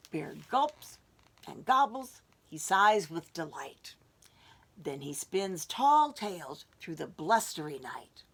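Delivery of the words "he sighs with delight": ordinary delivery, nothing marked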